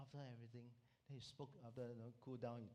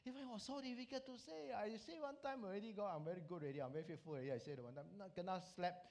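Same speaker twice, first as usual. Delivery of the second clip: higher-pitched, hammed-up complaint; mock protest; 6 semitones higher than usual. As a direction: argue back at an insistent prompting. Man protests to God about prompting.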